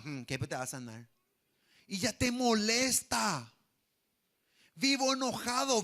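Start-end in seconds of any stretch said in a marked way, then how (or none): 0.0-1.1 low, muttered scoff; grudging disbelief. Acted. Resentful churchgoer reacting to another's testimony of healing, muttered doubt. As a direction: mutter it softly, as a sour sceptic.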